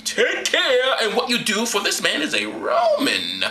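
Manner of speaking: high, slow mock proclamation; centurion's warning. Centurion warning his chief captain, hammed-up. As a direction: draw out the warning theatrically. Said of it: "told the chief captain, saying".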